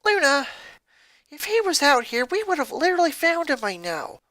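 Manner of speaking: lightly voiced bossy sister. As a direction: state it flatly to her sister. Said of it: "Lori stated".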